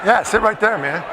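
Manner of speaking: usual speaking voice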